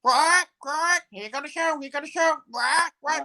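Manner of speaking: very high quacking cartoon duck. Duck impression for the show, very high and quacky.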